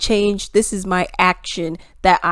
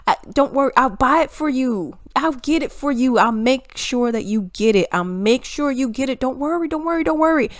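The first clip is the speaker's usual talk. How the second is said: higher-pitched, over-promising salesman patter; mock eager reassurance; 3 semitones higher than usual. Democrats promising voters they will get it, mock reassuring. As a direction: pile on reassurances, climbing higher.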